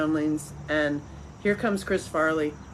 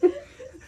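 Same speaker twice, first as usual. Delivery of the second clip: high, shrieking laugh; laughing hard at daughter's remark; burst of laughter. Real, not acted. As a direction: crack up at your own joke. Punchline about winning dance-off.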